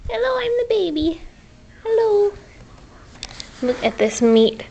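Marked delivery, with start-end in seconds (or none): 0.1-2.3 voicing the dog as a baby.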